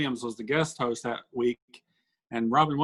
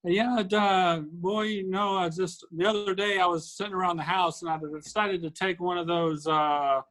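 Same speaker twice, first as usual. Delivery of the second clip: Willie and Frankie voice; 6 semitones higher than usual. Retold or imitated. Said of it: "they were like".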